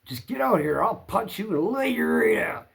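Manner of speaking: mock yelled threat, rising high; imitated threat. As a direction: act out the neighbor threatening a fight. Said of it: "he threatened my wife again".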